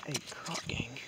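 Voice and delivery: hushed hunting whisper; whispering while stalking in the woods. Real, not acted. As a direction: whisper so the squirrels won't hear. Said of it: "These leaves are so loud".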